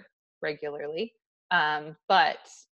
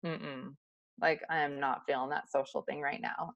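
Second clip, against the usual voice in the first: low, soft grumble of not wanting company; quiet reluctant mutter; 5 semitones lower, 8 dB quieter than usual. Retold or imitated. To herself in the store, hiding from a social chat, in a soft grumble. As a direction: mutter that you can't face people today. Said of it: "And I was like".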